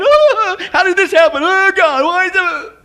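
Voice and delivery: loud, high-pitched mock wail; exaggerated, self-pitying bawling; whining complaint. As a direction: whine like a hammed-up victim. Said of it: "and then whining, complain".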